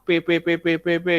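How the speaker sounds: each letter fired off impatiently; mock pestering student. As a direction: pester him like an impatient kid. Spamming teacher with messages.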